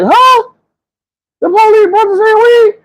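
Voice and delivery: high, loud disbelieving squeal; can't believe what you heard; retold shocked squeal; shocked disbelief. Shocked at police brother weed.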